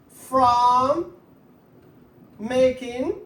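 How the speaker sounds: stretched words, long gaps between; slow dictation. Shown as a demonstration. Teacher writing out a second option for students, slow dictation. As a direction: dictate it word by word, leaving time to write.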